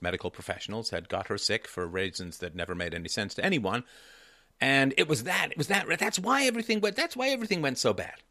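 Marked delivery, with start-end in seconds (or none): 4.6-8.2 high-pitched imitation of mother's excuse; mock blaming. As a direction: insist on it in a raised, mock-convinced voice. Mimicking mother's blame theory.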